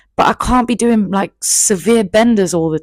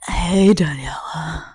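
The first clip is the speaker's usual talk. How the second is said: slow hushed whisper; soft whispered greeting; whispered boyfriend voice; 4 dB quieter, slower than usual. Retold or imitated.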